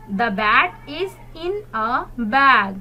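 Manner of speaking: very slow, clear sentence; slow model reading. Shown as a demonstration. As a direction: read it out word by word. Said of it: "Repeat after me".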